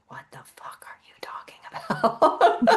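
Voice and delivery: half-laughing whispered disbelief; stunned whisper. Retold or imitated. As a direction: breathe it out in shocked amusement. Retold shock at psychic caller.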